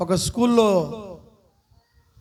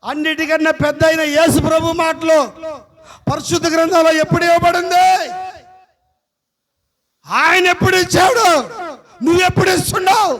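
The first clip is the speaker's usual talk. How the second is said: preaching with force; 4 semitones higher, 5 dB louder than usual. Acted.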